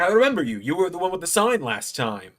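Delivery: high, animated wrestler impression; Joe Hendry. Wrestler remembers the fan with the sign, impersonated. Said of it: "not the best Joe Hendry impression".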